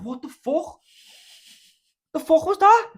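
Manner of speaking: high, loud startled outburst; sudden alarmed yell of disbelief; startled "what the fuck". Retold or imitated. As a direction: blurt it out shocked and confused. Startled half-asleep mate, acted.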